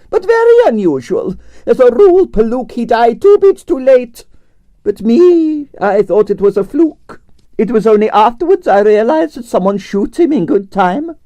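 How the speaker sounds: grand Russian ballerina complaining about her night; Russian prima ballerina. Acted. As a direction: play the dramatic Russian diva. Arienskaya held voice.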